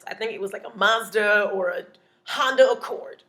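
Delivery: ordinary talk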